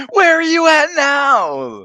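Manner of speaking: slow, high, loud mock yell; hammed-up drawn-out demand. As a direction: holler it like an all-caps text. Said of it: "I picture my head there yelling at me".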